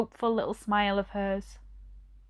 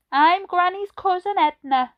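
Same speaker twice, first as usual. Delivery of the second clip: put-on old lady voice; 10 semitones higher, 6 dB louder than usual. A narrator's reading. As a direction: voice her like a gentle elderly lady. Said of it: "she said".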